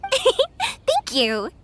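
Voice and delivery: laugh, then thank them kindly. Acted. Yoimiya thanking the villager with a laugh.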